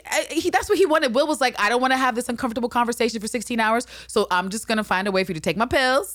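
high fast mock Will.

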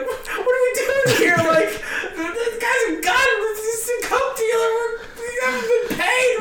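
very high, hammed-up panic; freaking out. Unpaid comics in the hotel conference room, freaking out. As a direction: squeal it out in mock panic. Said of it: "I'm freaking out".

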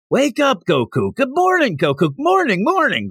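high-pitched imitation of urgent shouts; mock crowd yelling. Crowd trying to wake Goku, mock yelling. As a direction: call out like a crowd, without full volume. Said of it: "everybody in the crowd's just yelling".

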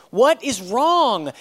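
slow, loud, each word hit: "What is wrong?"; urgent demand. Retold or imitated. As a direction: drag every word out, now alarmed. Calling again, more urgent.

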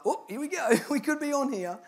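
amused "here we go" reaction; playful anticipation. Retold or imitated. Wry aside on answering a call.

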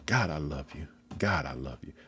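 low, soft, murmured to God; a private prayer spoken under the breath; quiet heartfelt prayer. Pretend. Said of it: "That's what I'm saying in my heart".

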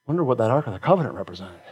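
soft, fast aside; hushed rhetorical wonder; quiet musing. Acted. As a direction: muse softly under your breath.

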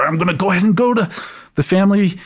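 hammed-up self-sacrifice; mock martyr.

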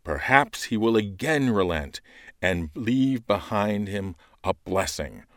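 loud, slow, solemn lector delivery; urgent call to return, rising and pausing; proclaimed scripture reading. Acted. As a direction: exhort the people from the ambo.

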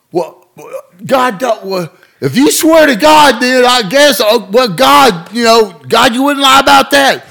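high, loud stammering back-down; flustered cop. Retold or imitated. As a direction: fumble around and give in. Cop gives in to the oath.

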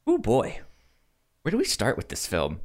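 ordinary talk, nothing marked

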